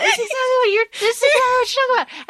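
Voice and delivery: very high, squealed mock protest; mock exasperation. Reader calling out a character's denial, mock exasperated. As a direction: repeat it in a high, incredulous voice.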